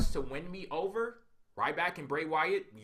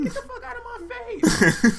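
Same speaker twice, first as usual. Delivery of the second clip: far higher, loud outburst with a laugh in it; furious mock yell; 10 dB louder than usual. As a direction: explode at him, half laughing. Acted wrestler snapping at ref.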